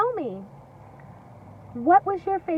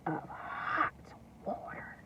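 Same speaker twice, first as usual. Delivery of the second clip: whisper; 4 semitones lower, 12 dB quieter, slower than usual. A narrator's reading. Suspense in story.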